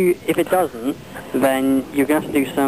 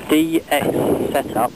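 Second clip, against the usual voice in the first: dictating; slower than usual. Real, not acted. Dictating what to type to caller.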